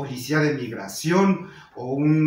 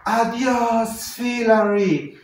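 high, drawn-out holler; man's shouted greeting; 7 semitones higher, 4 dB louder, much slower than usual. Retold or imitated. A man shouts to a passing cyclist, drawn-out holler. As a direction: call it out long and loud down the street. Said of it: "un señor que le grita".

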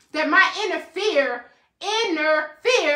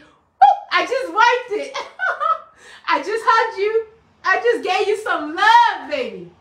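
laughing; 7 semitones higher, 5 dB louder than usual. Real, not acted. Wiping tears virtually, laughing.

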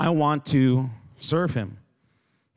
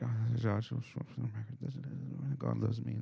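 very soft, mumbled musing; barely audible absorbed mumble; muttering under breath; 13 dB quieter than usual. Retold or imitated. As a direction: imitate someone murmuring to himself. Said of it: "mutter under their breath".

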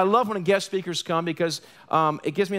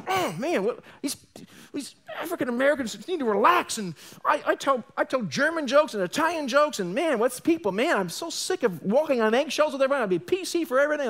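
higher, whiny, irritated griping; agitated rant; 8 semitones higher than usual. Retold or imitated. Pastor replaying his own irritated thoughts after coming home. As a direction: vent like a fed-up man. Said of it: "and I was agitated".

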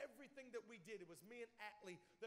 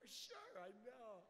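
slow, softer, drawn-out fake agreement; pretending to understand; 5 dB quieter, much slower than usual. Listener bluffing through talk they can't understand, feigned agreement. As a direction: play along as if you followed every word. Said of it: "you have no idea what they're saying".

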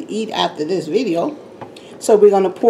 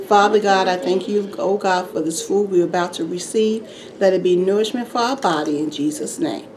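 saying grace; 2 semitones lower, 5 dB quieter than usual. Real, not acted. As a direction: pray quietly over the food. Says grace before eating.

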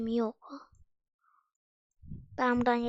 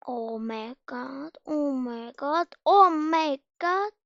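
building panic; 3 dB louder, much slower than usual. Real, not acted. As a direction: let the panic build with each one. Rising panic on the jump.

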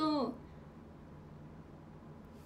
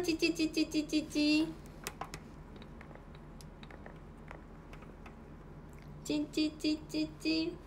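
tongue clicks; 3 semitones higher, 5 dB quieter, much slower than usual. Real, not acted. Calling the pet.

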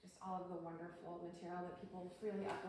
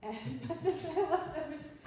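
laughing, louder and higher; 9 semitones higher, 10 dB louder than usual. Real, not acted. Joking to the audience about her partner, laughing. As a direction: laugh through the words fondly.

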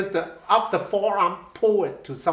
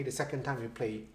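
dropped much lower and softer; piano, soft; 7 semitones lower, 12 dB quieter, faster than usual. Shown as a demonstration. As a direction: let it fall away to piano. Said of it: "piano on a repeat".

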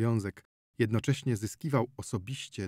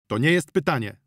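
loud protest; exclaiming; 4 semitones higher, 7 dB louder than usual. Acted. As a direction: call out the objection. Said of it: "zawołał doktor Pawlicki". (głośny protest)

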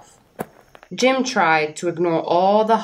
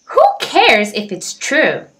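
bird character voice; 7 semitones higher, 5 dB louder than usual. Acted. Oxpecker's carefree bird voice.

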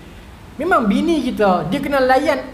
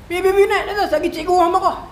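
high-pitched, urgent telling-off; imitating scolding father; 7 semitones higher than usual. Father sending child up to the hostel, scolding. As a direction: order the child upstairs crossly. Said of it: "marah saya terus".

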